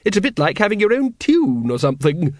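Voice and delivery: arch, high lady's voice; Lady Ramkin. Acted. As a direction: remark it archly with a smile. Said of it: "gave him an arch smile".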